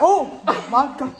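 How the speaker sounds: high loud laughing cry; laughing shout. Real, not acted. Copies sister loudly.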